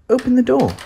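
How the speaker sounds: ordinary delivery, nothing marked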